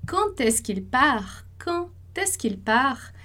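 slow, careful French question; slow French model phrase. Shown as a demonstration. Teacher repeating the model question for students, slow and careful. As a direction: say "quand est-ce qu'il part?" slowly, every syllable clear. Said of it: "você pode usar essa primeira estrutura".

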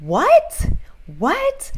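high, incredulous-sounding "what?"; expressive questioning. Shown as a demonstration. Teacher showing students how to express question words, exaggerated asking. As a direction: act out the question with a sharp rising pitch. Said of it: "expressar, isso é uma coisa física".

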